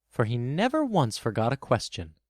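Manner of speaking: ordinary delivery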